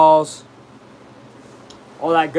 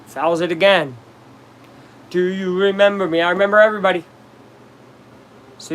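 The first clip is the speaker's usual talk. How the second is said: usual speaking voice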